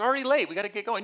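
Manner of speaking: put-on fast, high, loud kid protest. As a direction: hurry them along, keyed up. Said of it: "we're, like".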